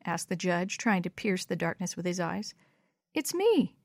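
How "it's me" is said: put-on high little child voice; small, high-pitched child's reply. A narrator's reading. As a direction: answer in a tiny, high voice. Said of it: "said a very small voice".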